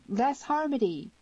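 high-pitched hail to someone; crying aloud. A narrator's reading. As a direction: call the full name, voice lifted high. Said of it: "she began to cry aloud".

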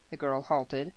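ordinary delivery, nothing marked

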